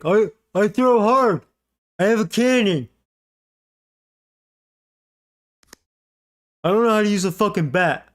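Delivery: high, loud, goofy voice of a boastful player; mock dumb jock. Gamer mocking his bad hitter Walker, in a goofy high voice. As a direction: ham up a dimwit bragging about his arm.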